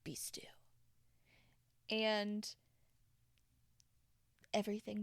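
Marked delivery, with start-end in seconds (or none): 0.0-0.4 soft breathy whisper of the command; whispered silence, be still. Shown as a demonstration. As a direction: hush it out like calming a storm. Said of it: "putting our arms out and going".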